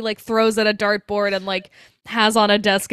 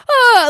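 high, louder frightened cry; higher scared yelp; 12 semitones higher, 9 dB louder than usual. Retold or imitated. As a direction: yelp like you're truly scared. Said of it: "he's just like".

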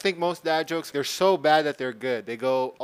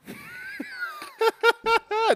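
very high, screaming laugh; shrieking laugh. Real, not acted. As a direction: cackle in a shrill scream. Wild laugh at own pun.

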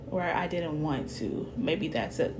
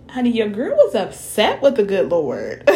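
laughing; 3 semitones higher, 8 dB louder than usual. Real, not acted. Laughing confession about being upset.

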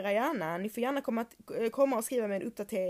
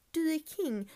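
"Du är king." lifted much higher; higher emphatic praise; 7 semitones higher than usual. Real, not acted. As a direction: hype her up on "Du är king.". ("Du är king." ljusare och peppigare)

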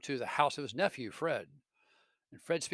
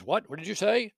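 high, loud startled question; the ghost pounces on Scrooge's amen, sharply surprised; 6 semitones higher, 8 dB louder than usual. Acted. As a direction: catch him out in surprise.

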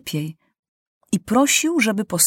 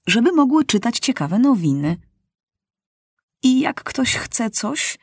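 plain reading voice

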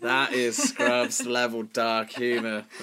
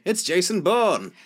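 higher, slow dramatic announcement; mock dramatic reveal; 7 semitones higher, 4 dB louder, slower than usual. Co-host closing his setup with the punchline. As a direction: drag it out theatrically.

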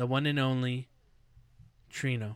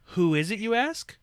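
loud, high teaser question; hyped-up announcer; 7 semitones higher, 7 dB louder than usual. Acted. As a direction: build the hype, loud and high.

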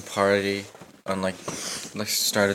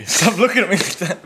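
laughing; 8 semitones higher, 5 dB louder than usual. Real, not acted. Laughing protest.

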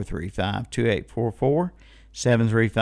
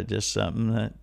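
ordinary talk

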